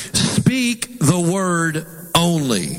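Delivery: "Speak the word only" drawn out, word by word; spaced-out, very slow, deliberate emphasis. Shown as a demonstration. Preacher wants to emphasize this verse, slow and deliberate. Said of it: "I really want to emphasize this".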